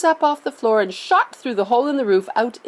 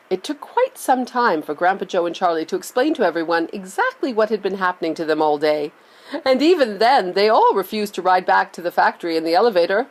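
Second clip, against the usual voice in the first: plain reading voice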